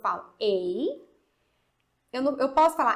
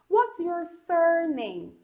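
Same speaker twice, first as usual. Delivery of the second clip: higher and slower "What's your full name?"; slow demo question; 6 semitones higher, 5 dB louder, much slower than usual. Shown as a demonstration. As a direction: ask it clearly, higher and slow. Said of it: "Então olha aqui".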